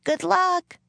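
high-pitched calling out, modest volume; shouted farewell. A narrator's reading. Annie waves off the departing wagon, calling out high. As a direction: call it out high, as to someone leaving. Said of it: "Annie waved and shouted".

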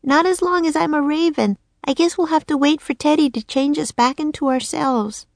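put-on high little-girl voice, croaked; a girl turned raven, croaking. Annie to Jack in the nursery, high croaking girl. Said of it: "she croaked".

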